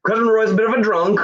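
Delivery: usual speaking voice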